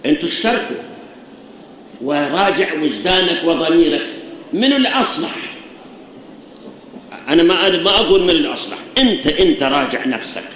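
ordinary talk

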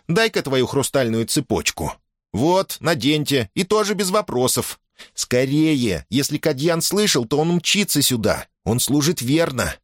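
put-on high-pitched girl giving orders; bossy, urgent girl. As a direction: hurry them, allowing no questions.

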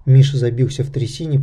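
plain reading voice

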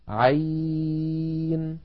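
عين stretched very long; held letter name. Shown as a demonstration. Teacher chants the letter, very slowly. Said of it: "حرف مد".

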